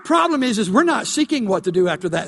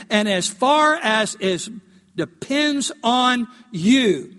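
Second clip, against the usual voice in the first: slow emphatic reread; slower than usual. Shown as a demonstration. Rereads the verse slowly, stressed.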